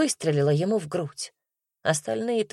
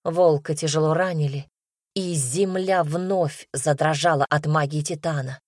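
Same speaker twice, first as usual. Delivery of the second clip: ordinary delivery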